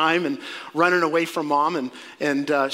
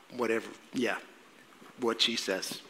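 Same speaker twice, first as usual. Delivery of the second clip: low, muttered husband's agreement; mock resigned mumble; meek deferral; 7 semitones lower, 10 dB quieter than usual. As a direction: give in under your breath. Joseph meekly defers to wife.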